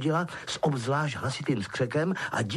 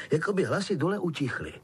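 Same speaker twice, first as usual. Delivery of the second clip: plain reading voice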